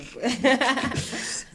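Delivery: bright burst of laughter; high laugh. Real, not acted. Guest laughs after bragging she is dope. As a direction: laugh at your own boast.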